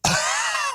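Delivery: a real coughing fit. Real, not acted. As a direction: cough hard, caught mid-sentence. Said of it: "Sorry, I was choking on".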